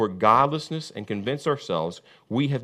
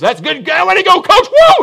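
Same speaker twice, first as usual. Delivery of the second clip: whooping players, much higher and louder; mock team cheer; 14 dB louder than usual. Football players cheering their coach, high whooping yells. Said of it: "teams yesterday playing football".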